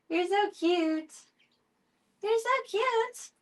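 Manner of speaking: high, slow cooing at the cats; sing-song pet voice. Real, not acted.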